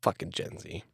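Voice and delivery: under-the-breath grumble; muttered aside. Real, not acted. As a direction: mutter it to yourself.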